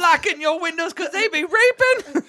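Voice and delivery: high laughing voice. Pretend. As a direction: laugh through the words. Laughing through joke line.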